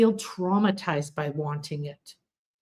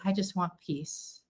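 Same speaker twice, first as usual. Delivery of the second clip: softly, almost under the breath; hushed wish for peace; quiet plea; 12 dB quieter than usual. Retold or imitated. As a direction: say it quietly, like a small prayer. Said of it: "I said".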